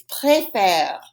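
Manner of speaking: "préfère" said slowly in two drawn-out syllables; pronunciation model. Shown as a demonstration. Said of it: "Say it after me".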